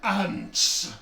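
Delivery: loud, high booming giant; giant's echoing voice. Acted. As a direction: call out over the canyon. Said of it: "echoes the voice throughout the canyon".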